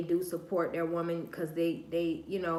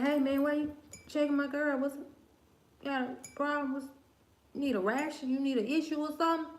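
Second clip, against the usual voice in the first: higher-pitched mock challenge; acted confrontation; 6 semitones higher, faster than usual. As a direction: confront him in a raised, put-on voice. Acted confrontation of another man.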